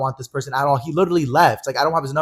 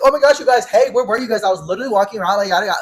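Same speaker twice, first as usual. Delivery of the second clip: high, loud mock-breezy greeting; acting like nothing happened; 7 semitones higher, 5 dB louder than usual. Friend greeting everyone at the car, breezily acting like nothing happened. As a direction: mimic her oblivious chirping, starting high and easing down. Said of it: "acts like nothing happened".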